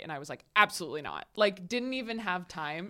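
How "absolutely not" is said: low, loud, emphatic retort; intense refusal. Retold or imitated. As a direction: act out a very intense, firm refusal. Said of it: "I'm very intense".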